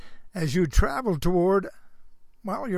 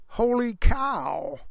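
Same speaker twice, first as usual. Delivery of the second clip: slow hammed-up "holy cow"; slow cartoonish exclamation; mock exclamation; 4 semitones higher, much slower than usual. Shown as a demonstration. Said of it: "should never be uttered".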